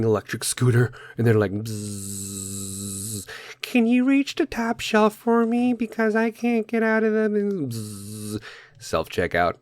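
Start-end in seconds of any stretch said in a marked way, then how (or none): 1.5-3.3 scooter buzz. Retold or imitated. Mocking lazy scooter riders. 3.6-8.4 very high, slow, whiny lazy-person voice; mock high whine asking for help; lazy helpless voice. Mocking lazy scooter riders.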